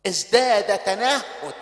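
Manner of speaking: normal talking voice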